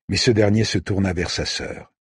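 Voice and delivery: usual speaking voice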